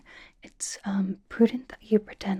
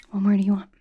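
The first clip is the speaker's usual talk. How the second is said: ordinary talk, nothing marked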